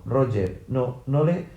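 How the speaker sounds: usual speaking voice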